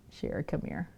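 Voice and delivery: soft, breathy coaxing call; coaxing whisper. Real, not acted. Owner whispering to coax her cat closer.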